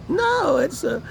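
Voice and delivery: high mock voice cutting in; mocking mother. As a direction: ape her dismissive start. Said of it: "now they're like".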